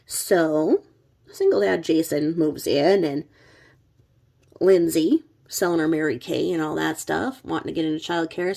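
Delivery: ordinary talk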